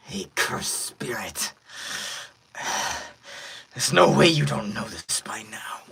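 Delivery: breathy wounded villain; beat-up villain. Acted. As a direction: wheeze out the threat. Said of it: "'cause he's beat up".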